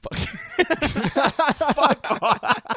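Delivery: high, loud mock-furious yelling; mock rage. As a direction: ham up an inconsolable fury. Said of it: "inconsolable anger is building".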